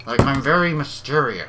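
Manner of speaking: through gritted teeth, lightly done; a weak Johnny Depp impression. Shown as a demonstration. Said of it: "not a very good Johnny Depp accent".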